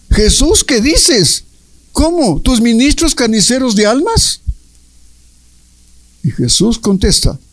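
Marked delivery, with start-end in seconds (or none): 0.1-4.4 high-pitched shocked questions, acted out; Luisa espantada. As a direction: read her appalled disbelief, pitched well up. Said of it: "espantada, dice Luisa". (espantada, con voz aguda e incrédula)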